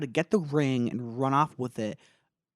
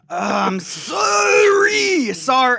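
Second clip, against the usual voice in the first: much higher, louder, very slow wailing cry; hammed-up sob; 12 semitones higher, 10 dB louder, much slower than usual. Frodo finally apologizing to Sam, mock crying wail.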